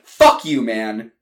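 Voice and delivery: "Fuck you" spat loud and falling; angry fuck you. Acted. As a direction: throw "Fuck you" hard, falling at the end. Brothers' quarrel.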